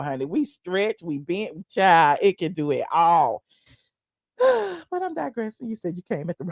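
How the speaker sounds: ordinary talk, nothing marked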